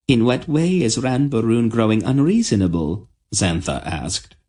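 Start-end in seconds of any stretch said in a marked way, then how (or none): 0.1-3.1 gently higher queen's voice; kind queen. A narrator's reading. The queen answering Tenedra, high and kind. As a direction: answer the girl fondly.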